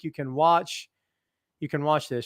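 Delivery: usual speaking voice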